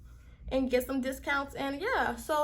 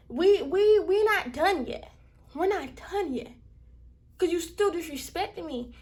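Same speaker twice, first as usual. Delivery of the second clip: heated vow; 4 semitones higher, 3 dB louder than usual. Real, not acted. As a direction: insist it isn't over. Vowing the fight continues.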